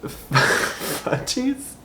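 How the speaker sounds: a high, breathy laughing "Fudgies?"; giggling incredulous repeat; laughing disbelief. Real, not acted. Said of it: "but fudgies?".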